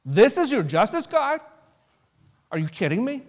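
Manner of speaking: 1 incredulous. Retold or imitated. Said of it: "it's like".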